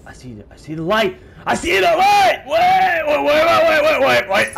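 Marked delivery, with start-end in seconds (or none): 1.4-4.6 high, loud urgent call; frantic shouted plea; shouting wait. Real, not acted. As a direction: yell after someone leaving. Calling the character to stop.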